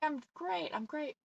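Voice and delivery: small, high, soft voice; mock reassurance. Insisting she is not mad despite her face, soft mock reassurance. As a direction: insist softly and high, playing at being fine.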